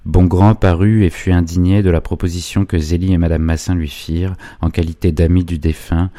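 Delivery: ordinary delivery, nothing marked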